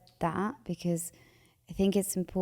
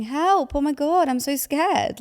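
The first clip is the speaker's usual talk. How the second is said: higher, louder mock panic; panicked inner voice; 7 semitones higher, 7 dB louder than usual. Anxious self crying out in mock panic. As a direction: cry out like a frightened part of you. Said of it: "basically just freaking out".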